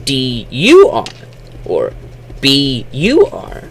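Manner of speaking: high, spaced letters with U popped up; spelled-out demo. Shown as a demonstration. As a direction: spell "B U R" slowly, lifting the U. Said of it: "It would often sound like".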